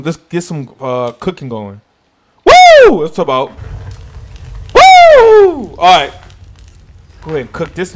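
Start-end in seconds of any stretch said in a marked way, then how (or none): 2.5-3.0 high, loud celebratory whoop. Real, not acted. Cooking going, celebrating. 4.7-5.7 high, loud celebratory whoop. Real, not acted.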